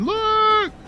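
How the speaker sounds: high, slow drawn-out call; imitating a yell. Owner retelling how they yelled for the dog near the house, in a high, drawn-out call.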